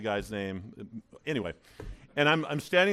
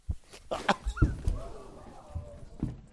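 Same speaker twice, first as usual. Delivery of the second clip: evil laugh; 4 dB quieter than usual. Acted. Mock evil laugh in sermon.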